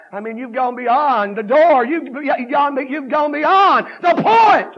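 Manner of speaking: high, loud, drawn-out chanted preaching; whooping revival preacher; fiery preaching. Acted. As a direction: preach hell at full force. Preaching at full force.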